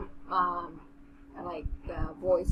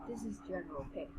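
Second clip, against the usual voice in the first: softer, quick aside; low voice; 13 dB quieter, faster than usual. A narrator's reading. Nan Kun informing Xie Lan who arrived, low voice. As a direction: say it discreetly under the narration. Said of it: "said in a low voice".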